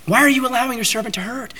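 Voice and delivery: high, pleading cry to God; a desperate plea to the Lord, voiced high. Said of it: "he's saying".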